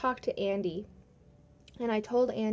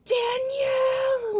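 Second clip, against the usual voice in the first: loud, high, drawn-out shout; yelling; 5 dB louder, much slower than usual. Retold or imitated. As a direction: call the name out long and high, imitating a boss's yell. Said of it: "was, like, yelling for me".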